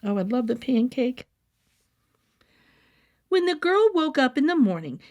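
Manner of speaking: ordinary delivery, nothing marked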